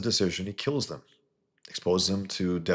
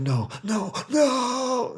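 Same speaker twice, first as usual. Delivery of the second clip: very high, rising, louder squealing no; mock whiny protest; 4 dB louder, much slower than usual. Mocking the blogger's protest, very high squealing no. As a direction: squeal it like a hysterical scold. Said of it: "I was like".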